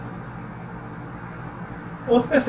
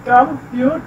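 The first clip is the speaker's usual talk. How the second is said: Computer divided as com, pyut, er; teacher sounding out computer in pieces; split into syllables; slower than usual. Shown as a demonstration. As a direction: pause between the syllables of computer. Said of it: "तकसीम करना है".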